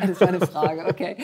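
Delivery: amused self-mocking echo; laughing echo. Real, not acted. Guest echoing the host's tease, laughing.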